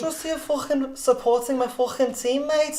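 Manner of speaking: high-pitched imitation Scouse; American's Scouse attempt. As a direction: have a rough go at a Scouse accent. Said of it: "For an American, it's not bad".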